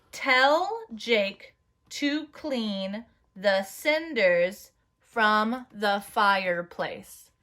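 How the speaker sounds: very slow, evenly spaced sentence; slow dictation. Shown as a demonstration. Teacher dictating a sentence to the class. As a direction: dictate each word slowly. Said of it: "I'm gonna say it first".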